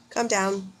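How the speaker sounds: ordinary delivery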